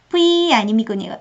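usual speaking voice

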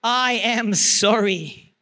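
slow, deliberate apology; demonstrated apology. Shown as a demonstration. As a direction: spell out each word slowly and firmly.

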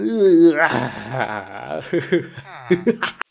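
laughing over the wreckage; acted manic laughter; crazed laugh. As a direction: break into an unhinged laugh. Laughs at broken computer.

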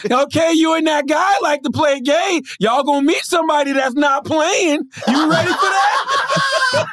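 high, slow, drawn-out mock warning; hollering old man. Fan's father warning the radio host, slow high mock holler. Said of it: "The old man said".

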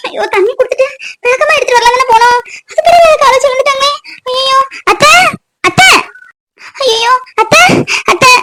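high-pitched alarmed wailing; frantic, rising calls; panicked. Acted. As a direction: cry out in dismay over someone fallen. Finds mother-in-law fallen, panics.

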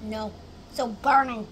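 glass near lips; speaking between sips. Real, not acted. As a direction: mouth wet with milk. Seen 0: Holds milk glass at chin, milk mustache, sips again by 0.8s.

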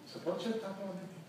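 high, soft rhetorical question. Real, not acted. Preacher asking the church in soft disbelief.

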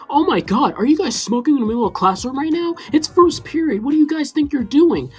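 shocked Mister Snack. Retold or imitated. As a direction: play the stunned chill teacher catching smokers.

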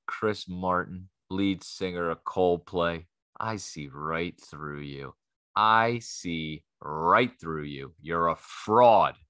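low, slow, drawn-out accusation of a fraud; slow suspicious accusation. Retold or imitated. Stoned comic tells the crowd what he thought of the singer, slow low accusing voice. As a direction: stare him down and say it slowly. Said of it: "I'm like".

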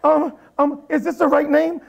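very high, loud, flustered question; comic panicked storytelling; retold panic. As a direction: stammer it in mock alarm. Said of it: "I was scared".

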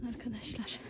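soft unvoiced whisper. Real, not acted. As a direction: keep it to a whisper. Whispering while foraging.

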